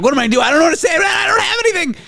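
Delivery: acted panic, pitch climbing to a shriek; freaking out. Radio host to his producer with no guest, panicking. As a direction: spiral up into a high neurotic panic. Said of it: "I'm freaking out".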